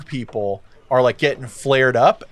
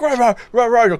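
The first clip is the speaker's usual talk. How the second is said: hogmen grunting rah; 10 semitones higher, 4 dB louder than usual. Acted. GM voices hogmen posturing.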